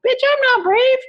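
high, sassy comeback; sassy retort. Retold or imitated. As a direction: snap back with sass. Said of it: "I'm like".

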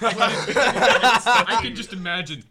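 high squealing laugh through words. Real, not acted. Friends laughing at story.

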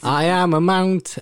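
high, slow, botched Scottish accent; attempted Scottish accent. Shown as a demonstration. Presenter doing an impression in a Scottish accent, badly faked. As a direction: attempt Scottish and land nearer Welsh. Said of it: "wanna do my impression in a Scottish accent".

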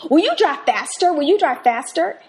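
high, loud impatient plea; pushy demand. Retold or imitated. Passenger nagging the taxi driver, high and loud. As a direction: push him to speed up. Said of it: "I was saying to the driver".

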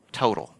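total said low and falling, set apart by a pause; total, a slow weighty fall after a beat; emphasis on total. Shown as a demonstration. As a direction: lean into the word total, then drop it. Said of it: "Emphasis on the word".

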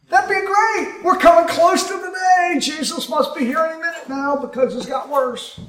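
high-pitched gleeful believer, hammed up; mock excitement. Preacher voicing a pre-trib rapture believer, mock excitement. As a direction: cheer it high, easing off toward the end.

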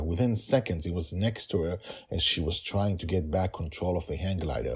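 ordinary delivery, nothing marked

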